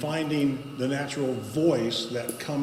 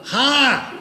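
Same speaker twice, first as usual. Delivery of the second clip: much higher, louder throat-squeezed ha; throaty ha; 11 semitones higher, 9 dB louder than usual. Shown as a demonstration. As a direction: bark it from the throat. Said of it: "Or your throat going".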